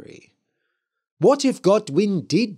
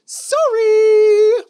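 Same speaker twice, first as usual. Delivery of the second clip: high, loud wailing witch; witch cry; 12 dB louder than usual. A narrator's reading. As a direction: shriek it like a frightened ghost.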